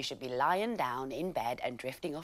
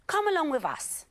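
lions calling; 6 dB louder than usual. A narrator's reading. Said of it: "said the lions".

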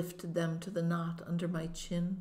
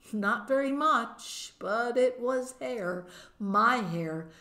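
slow, higher-pitched amazed delight; raised pitch, savoring the discovery; dawning wonder; 4 semitones higher, slower than usual. A narrator's reading. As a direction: marvel at it, drawing the words out. Girl discovers her hair growing back.

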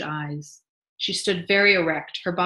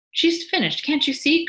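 much higher, louder pleading; exasperated, pitched-up objection; urgent protest; 10 semitones higher, 6 dB louder than usual. A narrator's reading. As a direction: protest in a raised, high voice. Wife protests over the bottle.

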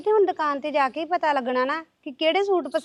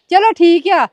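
irritated retort; 5 semitones higher, 8 dB louder than usual. Acted. Wife snaps back in quarrel.

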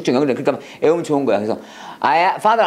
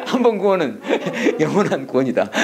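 chuckling between phrases; laughing. Real, not acted. Teacher joking to class about salvation slogan, laughing. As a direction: break up laughing at your own wordplay.

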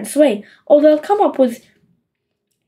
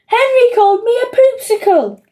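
much higher tattling whine; whining complaint; 9 semitones higher than usual. A narrator's reading. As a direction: tell on your brother, whining. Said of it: "wailed Peter".

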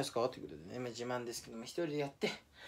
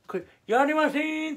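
"やりません" said again noticeably higher; higher replay of the refusal; 8 semitones higher, 4 dB louder, slower than usual. Shown as a demonstration. Showing viewers how his refusal looked, higher voice. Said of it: "これ、やりませんって".

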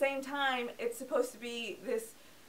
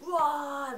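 short mock monster roar, a bit higher and louder; 4 semitones higher, 6 dB louder than usual. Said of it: "it just was like".

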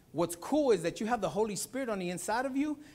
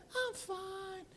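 imitating little daughter; 13 semitones higher, 8 dB quieter than usual. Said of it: "And she'd be like".

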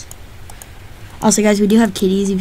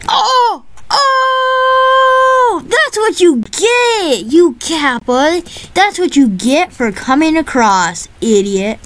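triumphant yelling; 8 semitones higher, slower than usual. Real, not acted. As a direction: scream it in triumph. Kills enemy in game, yelling.

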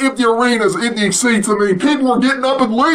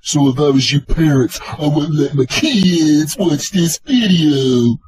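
much lower, mock-ominous warning voice; deep announcer voice; 12 semitones lower than usual. Vlogger warns viewers, in a deep mock announcer voice. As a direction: boom out the warning like a trailer narrator.

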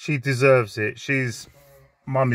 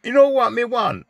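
imitating his Jamaican flatmate, pitched up in patois; Jamaican accent; 10 semitones higher than usual. Mimicking his Jamaican flatmate asking for a film, in high patois. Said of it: "So the Jamaican".

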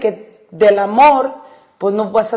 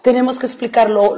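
normal talking voice

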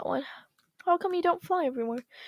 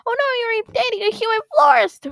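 higher, louder alarmed outburst; shocked shout; 8 semitones higher, 10 dB louder, faster than usual. Acted. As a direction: shout it in disbelief. Parent scolds son over human.

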